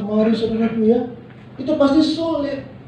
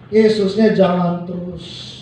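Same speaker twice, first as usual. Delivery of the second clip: usual speaking voice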